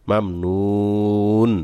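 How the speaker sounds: very slow মাম নুন, the final ন held closed; মাম নুন ending on a silent, held ন; stop on sakin. Shown as a demonstration. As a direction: recite মাম নুন stretching the vowels, no vowel after the last ন. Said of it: "এভাবে সাকিন করতে হবে".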